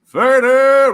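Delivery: loud, high hailing shout; calling out. Real, not acted. Streamer greeting an arriving viewer, calling out. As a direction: holler a big hello to a friend.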